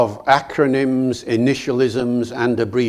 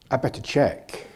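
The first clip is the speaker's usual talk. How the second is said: softer, doubting aside; quiet second thought; 8 dB quieter than usual. Retold or imitated. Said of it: "And then I thought".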